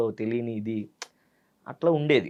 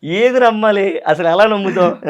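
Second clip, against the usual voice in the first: laughing disbelief; 6 semitones higher, 10 dB louder than usual. Real, not acted.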